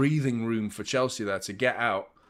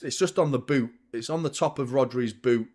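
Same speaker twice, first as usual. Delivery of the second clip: ordinary talk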